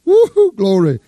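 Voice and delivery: loud, high whoop of glory; joyful whoop. Real, not acted. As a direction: shout it out to the congregation. Pastor whooping in praise.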